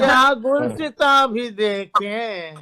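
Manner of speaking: stately nazm recitation. Acted. Reciting sher at mushaira.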